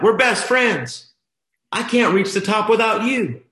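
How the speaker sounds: high, loud, impassioned cry; screamed out. Retold or imitated. Son to his dad on the climb, high impassioned scream. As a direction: scream it with feeling, stretching the words. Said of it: "He screamed it out".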